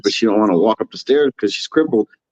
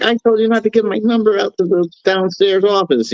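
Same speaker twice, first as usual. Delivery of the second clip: high-pitched imitation of yelling; imitated high, scolding voice; mock yelling; 10 semitones higher than usual. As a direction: scold someone in a high mock-yell, no extra volume. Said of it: "she's yelling at her".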